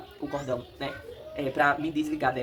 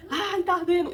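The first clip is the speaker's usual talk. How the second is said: much higher, louder cry of pain; retold scream of pain; 14 semitones higher, 5 dB louder, slower than usual. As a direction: cry out as the burning hits. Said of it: "eu dei um grito".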